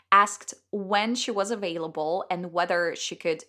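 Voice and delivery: ordinary delivery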